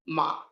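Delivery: MA low and level; grave level tone. Shown as a demonstration. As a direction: hold MA on a low, even pitch. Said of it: "this is the grave tone".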